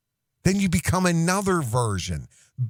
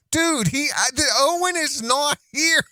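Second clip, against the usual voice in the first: much higher, exasperated put-on voice; high character voice; 10 semitones higher than usual. Character telling someone Owen is away, exasperated. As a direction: insist up in the silly voice.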